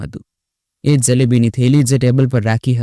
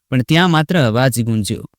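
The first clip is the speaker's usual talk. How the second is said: ordinary delivery, nothing marked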